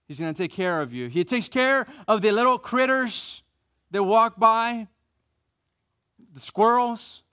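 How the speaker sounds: loud, high, driving preacher voice; preaching at full force. Acted. Preacher driving home God's provision to the congregation, loud. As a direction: rise up and preach it out over the room.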